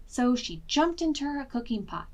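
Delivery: usual speaking voice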